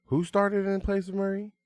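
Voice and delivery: high, leading question; pointed rhetorical question. Real, not acted. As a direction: ask it like everyone should know. Rhetorical question, hyping the player.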